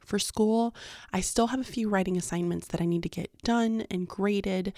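ordinary delivery

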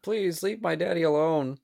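a little monster begging for its dad; child's plea. Acted. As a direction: beg like a scared small child. Voices the small monster pleading.

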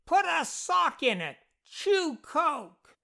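high-pitched indignant yelling; screaming. A narrator's reading. Jeff upset, screams from between the walls, high-pitched. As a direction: scream short insults, very high. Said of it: "Jeff screamed from his hiding place".

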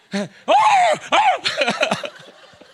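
very high, loud howl; mock dog howl. Preacher imitating the dog that cannot tell, mock howl. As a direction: howl loudly like a dog, then laugh.

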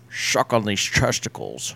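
Bane impression. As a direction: put on a villain mask voice, very slow. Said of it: "Why don't you say, as Bane".

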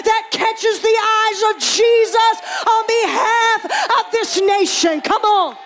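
loud, impassioned preacher's cry; full-force preaching. Acted. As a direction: stretch the big words out with rising fervour.

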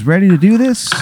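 high, pumped-up question; eager dad voice. Acted. Dad handing his son a shotgun, high and eager. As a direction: hype him up for the day. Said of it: "he goes".

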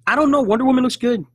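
very high, loud voice; high-pitched protest. Pretend. Co-host countering that Marvel will win again, high-pitched protest. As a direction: shoot up high and loud in doubt. Mock doubtful reply about DC's chances.

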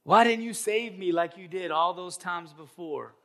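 mock-aggrieved outburst; indignant complaint. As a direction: demand an answer from God. Dead man complains to God.

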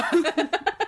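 loud, high hammed-up villain cackle; laughing villain cackle; mock evil laugh. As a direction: cackle like a cartoon baddie. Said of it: "add an evil laugh afterwards".

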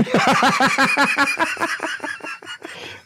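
high helpless laughter; bursting out laughing at the angry man. Real, not acted. Prank caller cracking up.

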